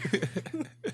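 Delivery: high-pitched burst of laughter. Real, not acted. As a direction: laugh high after your own punchline. Laughs after own punchline.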